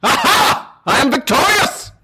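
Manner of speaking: triumphant knight. Acted. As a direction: crow over the stolen horse.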